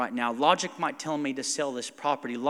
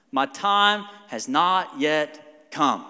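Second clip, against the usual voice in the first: very slow, solemn answer; Jesus' reply; 5 dB louder, much slower than usual. Retold or imitated. Jesus answers his mother slowly and solemnly. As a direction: draw each word out gravely. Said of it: "What was his response?".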